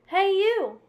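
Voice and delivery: loud, high call of challenge. A narrator's reading. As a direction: yell it out to pick a fight. Grouchy ladybug picks a fight.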